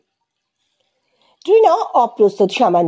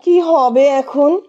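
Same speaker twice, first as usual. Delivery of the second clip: near tears; 4 semitones higher, much slower than usual. Acted. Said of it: "কেঁদে ফেলেছে প্রায়".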